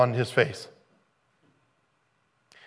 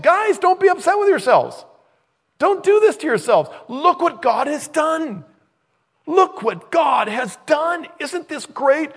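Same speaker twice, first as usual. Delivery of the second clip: much higher and louder, pleading with the guys; Joseph pleading; 8 dB louder than usual. Acted. Joseph urges his brothers, high, loud and pleading. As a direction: beg them not to blame themselves.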